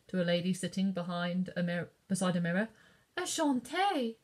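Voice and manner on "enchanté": put-on slow, higher French-accented greeting. A narrator's reading. French lady greets Katie in French accent. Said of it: "replied the lady".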